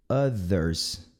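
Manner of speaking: OTHERS starting with a short "uh"; correct pronunciation. Shown as a demonstration. Teacher models the word for learners, "uh" vowel. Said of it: "Não é other".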